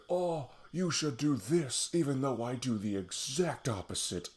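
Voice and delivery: sanctimonious lecture, hammed up slowly; mock preachy voice. Mocking a hypocritical preacher.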